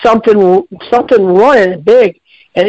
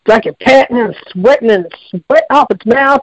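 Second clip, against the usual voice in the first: normal talking voice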